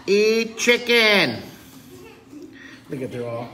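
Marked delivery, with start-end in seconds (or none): 0.0-1.4 high, loud, slow slogan; protesting fish. Pretend. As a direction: shout it as a fish demonstrator. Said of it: "they're carrying signs".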